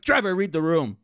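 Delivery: high, loud rebuke; loud, indignant telling-off; exasperated scolding. Retold or imitated. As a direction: snap at a tactless classmate. Scolding oblivious classmate's outburst.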